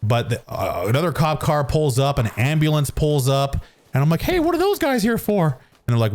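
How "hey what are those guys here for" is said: high-pitched, clueless mock question; naive questioning. Teen in the cop car asks about the ambulance, naive high voice. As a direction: ask it high, like you have no idea.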